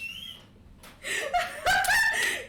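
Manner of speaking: high, loud laughter; real high laughing fit; big laugh. Real, not acted. Laughing at punch.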